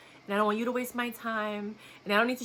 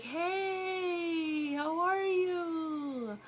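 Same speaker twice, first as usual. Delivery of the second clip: lightly imitated slow, drawn-out higher greeting; mimicking his slow talk; 9 semitones higher, 3 dB quieter, much slower than usual. Caller greets her in a slow, drawn-out imitation. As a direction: stretch every word out like him. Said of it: "I used to talk so slow".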